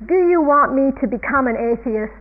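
loud retold yell; Calvin. Calvin's last attempt, yelling up at the gods. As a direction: shout the question upward in exasperation. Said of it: "he yells up at the gods".

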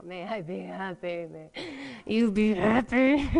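forcing the kind wish through irritation; strained metta phrase. Retold or imitated. Teacher retelling her retreat, mock strained metta phrase. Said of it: "I'm trying to go".

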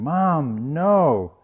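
loud, high cartoon yell; shouting. Satan to his mother in a cartoon, shouting in protest. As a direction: cry out with both words stretched high. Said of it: "he's shouting".

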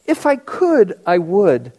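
high, lightly voiced mother with a laugh; a hint of the mother, amused; mom's wry reply. Mother teasing her son, lightly voiced, amused.

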